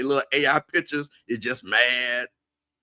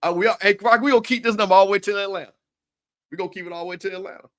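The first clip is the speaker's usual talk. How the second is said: excited; 7 semitones higher, 4 dB louder, faster than usual. Real, not acted. Hyped rallying for Atlanta.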